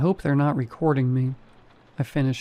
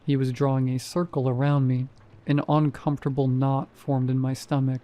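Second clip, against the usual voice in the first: ordinary delivery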